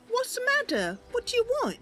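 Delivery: put-on high, puzzled girl voice; Mercy asking. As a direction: turn and ask what's wrong. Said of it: "Mercy turns and says".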